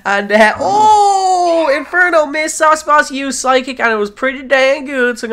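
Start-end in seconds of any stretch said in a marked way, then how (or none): none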